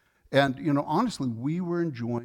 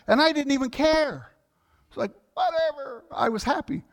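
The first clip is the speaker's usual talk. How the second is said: high bright-spirited, carefree lift; giddy carefree; 13 semitones higher, 5 dB louder than usual. Real, not acted. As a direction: float it up, not caring at all. Joyful indifference to onlookers.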